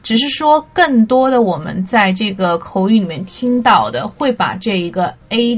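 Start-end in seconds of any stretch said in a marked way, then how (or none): none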